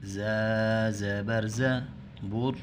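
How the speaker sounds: slow, drawn-out recitation of each syllable; slow spelling drill. Shown as a demonstration. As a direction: spell it out for children to repeat. Teacher spells out the letters slowly. (آہستہ ہجے کی مشق)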